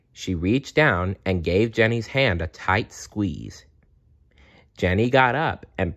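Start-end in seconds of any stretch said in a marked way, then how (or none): none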